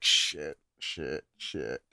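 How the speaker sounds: low, hushed sinking swear; hushed dread. Retold or imitated. Telling friend how heart felt that rainy Friday, hushed dread. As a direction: swear under your breath, sinking each time.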